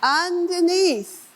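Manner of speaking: underneath stretched high and slow; drawn-out stress. Shown as a demonstration. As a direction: lean on underneath, long and high.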